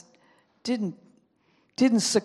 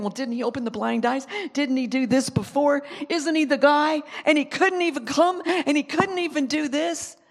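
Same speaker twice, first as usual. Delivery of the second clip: naysayers; 6 semitones higher, 3 dB louder than usual. Acted. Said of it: "people even saying".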